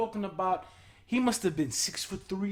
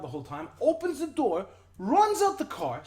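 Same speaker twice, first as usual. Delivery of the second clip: ordinary talk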